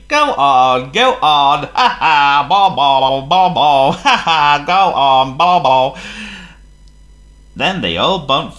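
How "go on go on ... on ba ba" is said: loud, high jeering chant; jeering trucks. Acted. Trucks jeering as they push Thomas. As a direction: taunt and cackle.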